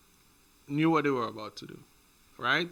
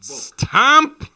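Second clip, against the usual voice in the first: excited exclaim; 11 semitones higher, 13 dB louder than usual. Real, not acted. Joking about Olympic qualification.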